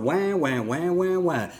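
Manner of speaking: put-on babyish wah-wah taunt; mock crying wah wah. Preacher mocks people who whine to avoid baptism. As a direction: whimper like a crybaby. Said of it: "quit whining".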